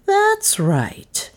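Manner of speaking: high bold maid voice; a hint of a bold housemaid; lightly voiced maid. A narrator's reading. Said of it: "unappalled for once".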